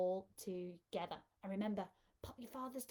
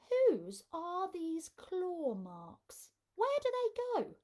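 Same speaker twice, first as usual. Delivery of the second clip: slow and louder, rising very high at the end; excited suspense; 8 semitones higher, 5 dB louder, slower than usual. A narrator's reading. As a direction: thrill the kids with wonder. Reader builds suspense for kids.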